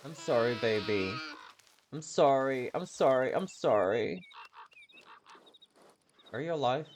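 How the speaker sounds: normal talking voice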